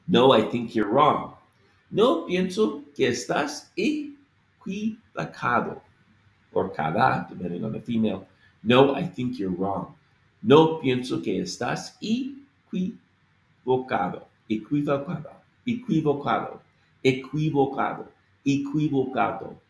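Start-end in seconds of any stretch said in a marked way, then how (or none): 1.9-5.8 slow No, pienso que estás equivocado, every syllable; slow, careful. Shown as a demonstration. Teacher modelling the phrase slowly for students. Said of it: "you have to slow down". 10.4-19.6 slow No, pienso que estás equivocado, every syllable; slow, careful. Shown as a demonstration. Teacher modelling the phrase slowly for students. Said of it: "you have to slow down".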